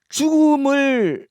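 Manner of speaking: 죽음을 stretched slow and high; drawn-out word. Acted. Preacher stretching 죽음을 slowly for drama.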